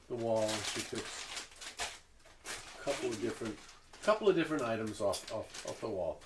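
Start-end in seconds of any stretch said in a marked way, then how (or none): none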